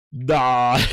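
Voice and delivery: ordinary talk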